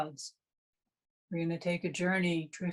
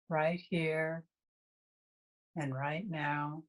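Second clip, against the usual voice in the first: guided meditation. Real, not acted. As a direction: guide them through breath awareness, leaving long silences between phrases.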